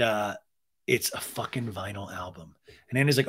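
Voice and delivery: usual speaking voice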